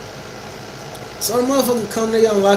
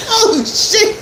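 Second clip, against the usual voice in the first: higher mock-shocked oh shit; surprised. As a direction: play it amazed, voice jumping up. Reacts to friend's words.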